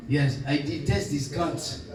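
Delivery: rough Mauritian accent, self-mocking imitation; old Mauritian uncle in a Mauritian accent. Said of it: "my atrocious Mauritius, Mauritian accent".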